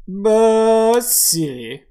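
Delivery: put-on loud, high, stretched drunken syllables; drunk man. Drunk customer ordering at the cart, loud and tipsy.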